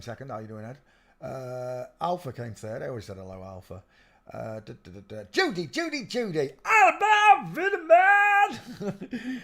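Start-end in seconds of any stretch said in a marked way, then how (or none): 5.3-9.4 silly high voice. Pretend. Silly sing-song name calling.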